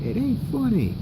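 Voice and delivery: tiny, high, faint voice; barely audible. Acted. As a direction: protest in a very soft, high little voice. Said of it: "I could barely hear what he was saying".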